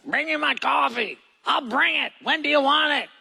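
high, far-off mock Bedouin voice, curt; hammed-up impression of a herder; Bedouin herder talk. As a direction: imitate a brusque herder demanding coffee. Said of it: "they always talk like this".

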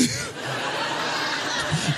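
quiet breathy laugh; soft laugh. Real, not acted. Comedian laughing at the name joke, soft and airy. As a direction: let out a soft chuckle.

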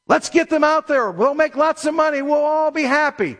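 high, sing-out mock enthusiasm; hammed-up eagerness, held high; mock eager parents. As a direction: imitate hopeful parents selling the dream. Voices parents pushing their kids.

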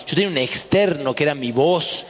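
usual speaking voice

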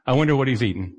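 ordinary talk

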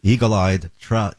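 ordinary talk

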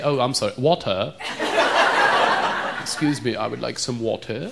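careful, soft WATER, pronounced "wal-tuh"; over-polite WATER as "wal-tuh", softly; corrected accent on water. Shown as a demonstration. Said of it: "I'm, I'm working on it".

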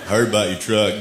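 lightly mock the second Texas guy. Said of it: "I heard these two guys talking".

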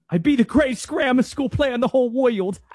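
high, loud put-on rapper voice. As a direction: boast it as a hammed-up rapper, capped with a laugh. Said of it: "do you wanna hear my very limited [name] impression?".

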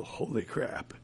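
hushed, under-the-breath exclamation; hushed amazement. Retold or imitated. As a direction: say it softly, as if stunned. Amazed reaction to the playing.

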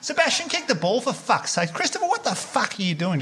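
high-pitched sideline dad rant; mock abusive yelling. Dad on the sideline at a junior soccer referee, mock yelling. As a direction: imitate a dad berating ref and kids. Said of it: "Yell and abuse at the ref".

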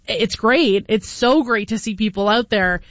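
ordinary talk, nothing marked

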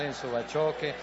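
ordinary delivery

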